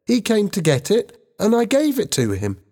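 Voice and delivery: high-pitched schoolboy voice; young boy. A narrator's reading. Ben tells older students what happened, young boy.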